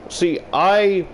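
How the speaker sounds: ordinary talk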